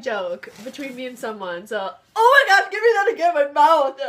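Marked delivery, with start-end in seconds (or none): 2.2-4.0 high loud panicked pleading; yelling as the mouth burns; frantic shout. Real, not acted. Horseradish burning mouth.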